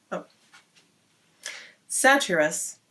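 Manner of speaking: ordinary delivery, nothing marked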